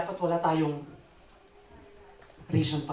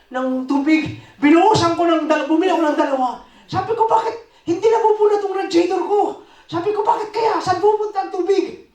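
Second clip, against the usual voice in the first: agitated retelling; 11 semitones higher, 7 dB louder than usual. Retold or imitated. Frantic retelling of car breakdown.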